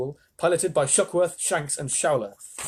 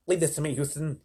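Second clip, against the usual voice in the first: usual speaking voice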